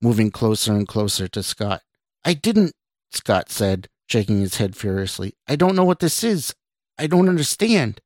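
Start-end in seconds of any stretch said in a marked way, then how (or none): 2.3-2.8 high, alarmed protest; frantic denial. A narrator's reading. Scott denies it to the approaching woman, frantic. Frightened man denies the accusation. 5.5-8.0 high-pitched, panicked confusion; frantic protest. A narrator's reading. Scott shakes his head at the woman, frantic protest.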